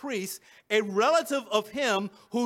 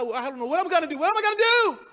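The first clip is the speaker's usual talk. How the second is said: rising to a high, loud outcry; acted panic, building as it repeats; mounting desperation; 6 semitones higher, 3 dB louder, much faster than usual. Peter at a loss after denying Christ, desperate.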